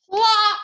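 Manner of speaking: big splashy plop word; loud high plop. Acted. Teacher reading to the class, a loud high plop. Sound of pine cone landing.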